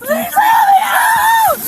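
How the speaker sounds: high, drawn-out desperate cry; desperate screaming. Real, not acted. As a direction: beg for help, screaming. Woman screaming for help.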